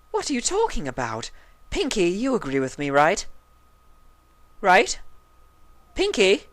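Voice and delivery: high, rising bewildered calls; puzzled Rarity. A narrator's reading. Rarity seeking Pinky's agreement, high. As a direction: call out, baffled.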